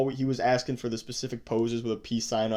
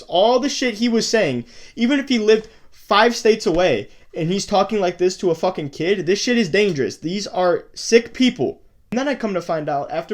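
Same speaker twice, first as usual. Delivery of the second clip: louder, higher angry rant; outraged; 6 semitones higher, 6 dB louder than usual. Real, not acted. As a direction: vent real outrage at the viewers. Outraged rant about predator.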